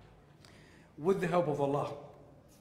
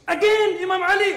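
very loud, very high emotional outburst; the preacher crying out the name in real emotion; 14 semitones higher, 11 dB louder than usual. Real, not acted. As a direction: cry it out at full force, overcome. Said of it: "Sorry, I get so emotional".

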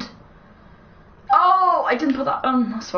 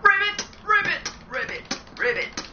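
frog imitation; 5 semitones higher, 4 dB quieter than usual. Gamer idly imitating a frog, playful croaks. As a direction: croak like a frog, starting high and fading away.

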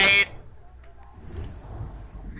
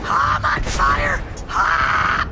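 yelling; 6 dB louder, much slower than usual. Real, not acted. Burning in game, yelling.

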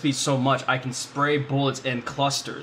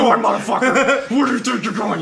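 high, loud laughing taunt at a fleeing foe; taunting yell; 9 semitones higher, 7 dB louder than usual. Real, not acted. Player jeering at the enemy he chases, loud laughing taunt. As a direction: jeer at him while laughing, loud and high.

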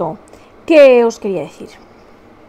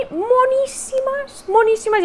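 high, slow gushing over cute glasses; swooning over the new glasses, drawn out high; 12 semitones higher than usual. Real, not acted. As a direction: coo it in delight.

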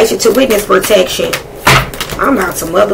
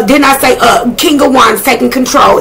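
ordinary delivery

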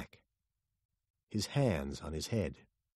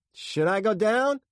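high, loud query; raised voice, asking urgently; shouted question; 13 semitones higher, 7 dB louder than usual. A narrator's reading. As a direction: pilot calling out for orders. Pilot asks in helicopter emergency.

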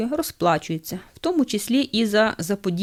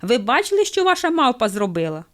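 bartender calling out, higher; mild acted yell; 4 semitones higher, 3 dB louder than usual. A narrator's reading. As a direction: half-shout an accusing question. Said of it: "Бармен кричить до хлопця".

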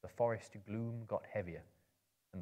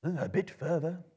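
put-on higher, louder old wizard; brisk wizard urging on; Gandalf's voice; 8 semitones higher, 6 dB louder than usual. A narrator's reading. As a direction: wizard answers the tired hobbit. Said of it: "said Gandalf".

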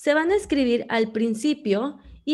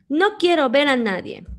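higher, louder indignant exclamation; exclaimed; 5 semitones higher, 4 dB louder than usual. Shown as a demonstration. Teacher showing an exclamation, indignant outburst. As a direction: push them all away with the words. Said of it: "en cada una de las oraciones se está expresando algo".

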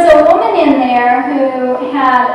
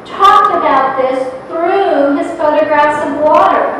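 usual speaking voice

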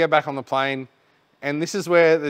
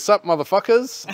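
put-on cocky young pilot; 8 semitones higher, 5 dB louder than usual. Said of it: "First guy walks up and goes".

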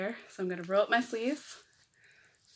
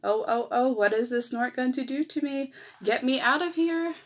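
mock panic; 5 semitones higher, 6 dB louder than usual. Bird scared carried by snort.

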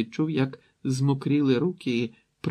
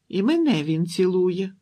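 put-on high, loud little-girl huff; sulking child; 6 semitones higher, 5 dB louder than usual. A narrator's reading. Younger girl insists she gets kissed too, sulking. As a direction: insist with a pout. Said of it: "надулася Бетті".

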